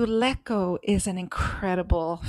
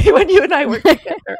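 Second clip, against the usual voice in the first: high, loud, laughing through it; laughing restart; 8 semitones higher, 12 dB louder, faster than usual. Shown as a demonstration. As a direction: laugh while restarting the sentence.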